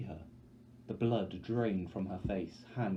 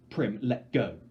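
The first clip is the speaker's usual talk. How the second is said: louder, hard-edged order; harsh command; 8 dB louder, slower than usual. Acted. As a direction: push her away to hold back tears. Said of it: "I say harshly".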